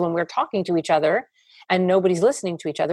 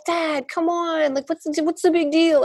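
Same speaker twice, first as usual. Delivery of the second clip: protesting daughter; 9 semitones higher than usual. Acted. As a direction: play a daughter brushing off dad's worry. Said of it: "If she's like".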